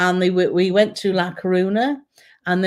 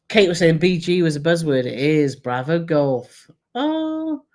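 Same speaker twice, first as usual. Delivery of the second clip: ordinary delivery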